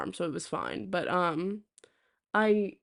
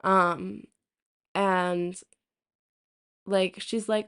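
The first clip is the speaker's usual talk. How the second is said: usual speaking voice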